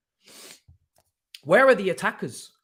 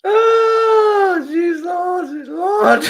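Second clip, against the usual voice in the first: high, loud cry of laughter; helpless laughing outcry; laughing shout; 14 semitones higher, 11 dB louder, much slower than usual. Real, not acted. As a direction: howl it out mid-laugh. Breaks down laughing at lineup.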